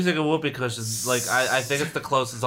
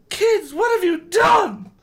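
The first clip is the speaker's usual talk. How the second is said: very high, slow, loud shout; mother shrieks at the kids; crying out; 4 dB louder, slower than usual. Acted. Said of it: "Missus Brewer cried".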